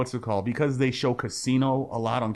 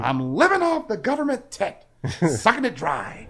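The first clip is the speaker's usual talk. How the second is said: much higher, louder put-on Lieutenant Dan, slowing on the end; 9 semitones higher, 4 dB louder than usual. Lieutenant Dan answers Forrest, loud high mocking voice. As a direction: bark it out as the bitter vet. Quoting Lieutenant Dan's reply.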